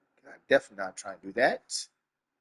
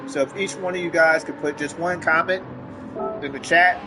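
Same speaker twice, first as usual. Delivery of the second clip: streamer hype voice; 5 semitones higher, 4 dB louder than usual. Real, not acted. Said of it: "get my nug voice back".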